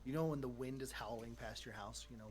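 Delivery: usual speaking voice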